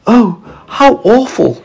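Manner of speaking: put-on high, slow shocked boy; horrified storybook child, high and slow; sympathetic young James. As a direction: react with drawn-out, high-pitched dismay.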